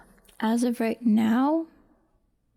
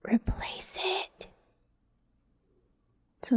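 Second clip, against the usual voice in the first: whisper; 10 semitones higher, 7 dB quieter, slower than usual. Real, not acted. Careful task, hushed while working.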